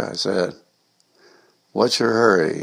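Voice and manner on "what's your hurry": plain reading voice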